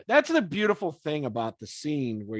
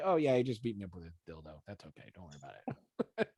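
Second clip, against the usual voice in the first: sheepish mumble; 8 semitones lower, 9 dB quieter, much faster than usual. Pretend. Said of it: "How do you explain that to your mother?".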